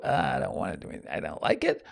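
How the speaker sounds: mock whiny refusal, nearly under the breath; sulky whine. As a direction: sulk at your own nose. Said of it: "I can look at that nose and go".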